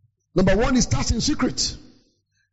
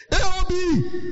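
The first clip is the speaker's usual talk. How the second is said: high announcing call; 9 semitones higher, slower than usual. Retold or imitated. Calling out any other business.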